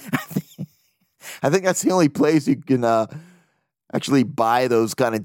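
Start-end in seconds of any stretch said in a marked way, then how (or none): 0.0-0.7 laughing. Real, not acted. Laughing at own joke.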